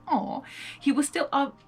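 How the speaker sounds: ordinary delivery, nothing marked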